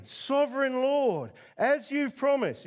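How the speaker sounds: put-on slow, solemn old man's prayer; Simeon's prayer. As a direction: lift it to God slowly. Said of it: "Simeon says out loud".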